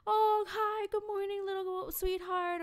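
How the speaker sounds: put-on high, slow, syrupy greeting to a toddler; sugary creepy old man. Mimicking the old man cooing at the two-year-old, high and sugary. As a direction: coo it like a creep charming a child. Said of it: "he'll look at her and he'll be like".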